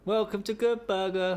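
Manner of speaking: slow, laughing movie cashier quote; Good Burger greeting. Pretend. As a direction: drawl it like the Good Burger clerk. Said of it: "Were you like the movie?".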